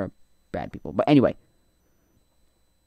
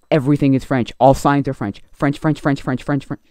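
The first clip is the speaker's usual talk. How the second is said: louder, building irritation; exasperated rant; 3 semitones higher, 8 dB louder than usual. Real, not acted. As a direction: hammer the repeated word in annoyance. Ranting about French everywhere.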